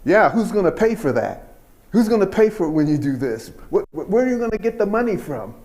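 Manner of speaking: loud, fast grumbling questions; worried dad demanding who pays; skeptical father. Retold or imitated. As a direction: press him about the money. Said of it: "he would say".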